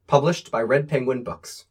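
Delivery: usual speaking voice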